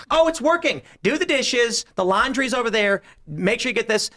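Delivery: high, cheery owner barking chores; mock bossy owners. Owners ordering their new household robot around, high and cheery. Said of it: "they're like".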